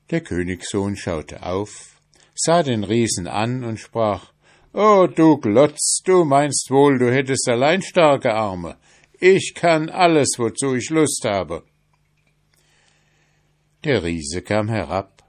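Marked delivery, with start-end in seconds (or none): 4.7-11.6 bold prince. A narrator's reading. Prince taunts the giant from below, high and loud. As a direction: crow it up at the giant, fearless.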